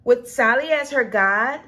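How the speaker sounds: ordinary delivery, nothing marked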